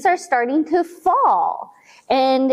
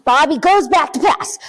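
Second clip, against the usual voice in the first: usual speaking voice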